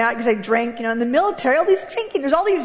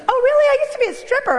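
surprised; 10 semitones higher, 3 dB louder, much faster than usual. Retold or imitated. Church lady's eager confession.

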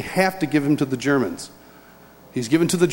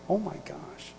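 very soft, awestruck aside; hushed awe; 15 dB quieter than usual. Retold or imitated. Awed listener reacting to his father's speaking, hushed. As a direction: drop right down in amazement.

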